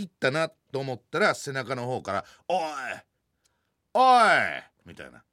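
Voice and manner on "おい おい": put-on freshly broken teen voice. Teen boy taunting him from behind, loud cocky call. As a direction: call out like a brash boy whose voice just broke. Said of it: "声変わりしたての可愛らしい声".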